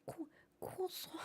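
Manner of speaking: Cro-, croissant drawn out, high and hushed; mock-French croissant to tease; exaggerated French croissant. Shown as a demonstration. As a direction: stretch croissant slowly in a breathy French way. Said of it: "hear you die a little inside".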